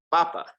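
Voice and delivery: papa with the weight on the first syllable, PA-pa; stress on first syllable. Shown as a demonstration. Teacher shows papa with no accent mark, stress on the first syllable. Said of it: "second to last syllable that's gonna get the emphasis".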